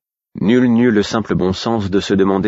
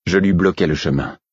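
ordinary delivery, nothing marked

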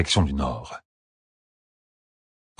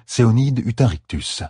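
ordinary delivery

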